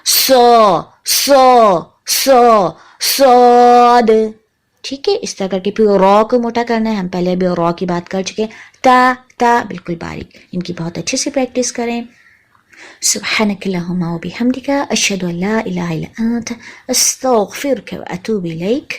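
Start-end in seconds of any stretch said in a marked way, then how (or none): 0.0-4.1 سو and سواد louder, thick and hollow; heavy saad. Shown as a demonstration. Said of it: "تو صوت موٹا ہو جاتا ہے". 13.0-18.9 soft unvoiced recitation; quiet whispered Arabic supplication; whispered prayer. Real, not acted. Closing supplication recited softly.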